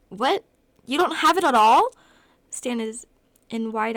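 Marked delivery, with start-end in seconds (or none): 0.1-1.9 loud, high-pitched incredulous outburst; shocked disbelief. A narrator's reading. Brother learns his sister has no inhaler, shocked. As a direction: burst out aghast, rising sharply.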